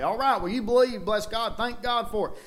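lightly voiced scoffing preacher, up high; mocking other church. Preacher mocks other church's doctrine, high mocking voice. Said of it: "They say".

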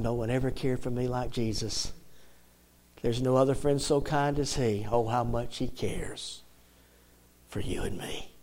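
soft, low hymn words, spoken; tender recital. Real, not acted. Preacher recites Charles Weigle's song to the congregation, tender recital.

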